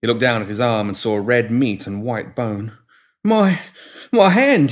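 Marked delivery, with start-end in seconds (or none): 3.2-4.7 shocked. A narrator's reading. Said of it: "he murmured".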